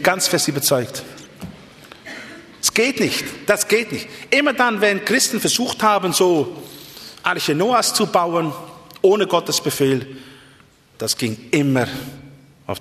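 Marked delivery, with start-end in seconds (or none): 2.6-10.2 high, insistent pulpit refusal, slowing later; impassioned preaching. Real, not acted. Preacher rejects Christians building their own arks, impassioned preaching. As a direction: insist from the pulpit with raised pitch.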